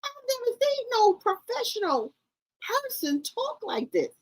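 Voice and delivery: high-pitched disbelief, easing down at the end; appalled, pitch way up; incredulous. Real, not acted. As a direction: squeak out your disbelief. Appalled comparison with other professionals.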